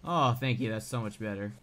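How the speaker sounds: normal talking voice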